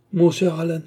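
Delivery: a lifted cry of address; exclaiming. A narrator's reading. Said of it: "s'écria Yogi".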